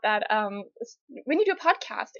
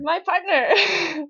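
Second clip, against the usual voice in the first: slow, teasing, drawn-out echo; 6 semitones higher, 5 dB louder, much slower than usual. Real, not acted. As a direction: stretch it out playfully. Playful drawn-out echo of prompt.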